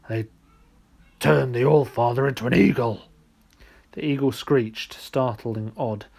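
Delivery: gruff bear. Acted. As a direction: grumble out the tale like a wronged bear. The bear tells their exile.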